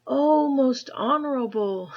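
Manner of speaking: put-on high, loud cry of a boy; Chang's first loud try; urgent boy's call. A narrator's reading. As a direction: shout it out as little Chang. False start, then restarted.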